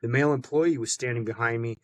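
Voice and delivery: normal talking voice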